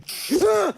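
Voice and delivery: short, high, loud scream; one loud high shriek. Real, not acted. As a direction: let out a sudden high-pitched scream. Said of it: "I do not want to scream like that".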